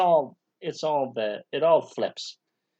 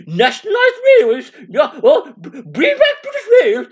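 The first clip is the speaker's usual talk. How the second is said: very high, loud squawked slogan; hammed-up protester's squeal; mock socialist; 12 semitones higher, 8 dB louder than usual. Socialist demanding British Rail back, high mock squawk.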